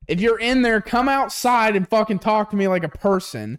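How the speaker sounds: high, heated demand; exasperated rant. Acted. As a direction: act out fed-up ranting at a doorbell camera. Acted rant at doorbell camera.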